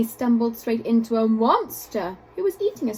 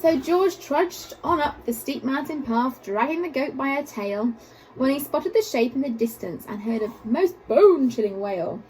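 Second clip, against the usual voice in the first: ordinary delivery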